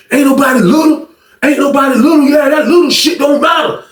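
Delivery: high shouted retort; mock angry yell. Short guy yelling that his size doesn't matter, high mock shout.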